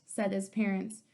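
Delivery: ordinary delivery, nothing marked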